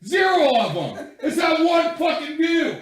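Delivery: loud, laughing mock outrage; half-laughing yell about zero listeners; laughing shout. Real, not acted. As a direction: yell it out, cracking up.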